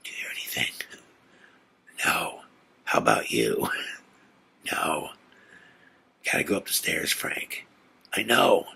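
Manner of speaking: hushed, half-whispered exchange; super low voice. Retold or imitated. Two friends creeping into a silent house, super low voice. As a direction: keep it barely audible, mostly whispered. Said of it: "like, in this super low voice".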